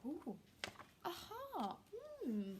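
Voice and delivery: usual speaking voice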